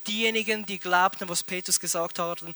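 ordinary talk, nothing marked

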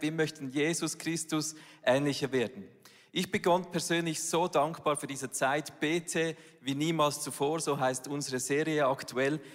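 normal talking voice

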